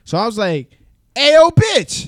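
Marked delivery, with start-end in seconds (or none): 1.1-2.1 high, loud furious call-out; a drunk man's outraged shout; angry yell. Retold or imitated. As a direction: bark it at her across the room. Confronting the girl, drunk.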